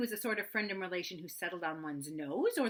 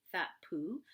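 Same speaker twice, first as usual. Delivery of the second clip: plain reading voice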